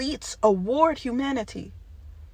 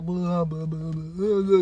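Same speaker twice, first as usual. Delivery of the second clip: dismissive blah; 6 semitones lower, much slower than usual. Retold or imitated. Dismissing compliments.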